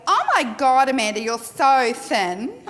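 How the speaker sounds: loud, gushing mock praise; gushing exclamation. Shown as a demonstration. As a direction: blurt it out like a thoughtless compliment. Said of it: "that's not a compliment".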